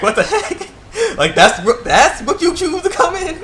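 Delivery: high, laughing what-the-heck; laughing disbelief. Real, not acted. Vlogger cracking up at the robot costume, high laughing disbelief. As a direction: laugh through the words in disbelief. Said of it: "I was laughing".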